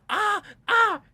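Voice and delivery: two loud, high-pitched retold yelps; panicked yelp. Man re-enacting hornet landing on his head, panicked yelps. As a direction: re-enact a startled scream, twice.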